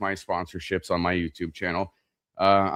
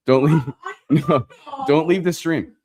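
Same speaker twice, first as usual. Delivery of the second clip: higher, louder playful plea; pleading; 8 semitones higher, 6 dB louder than usual. Real, not acted. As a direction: beg the viewers not to go. Pleading with viewers.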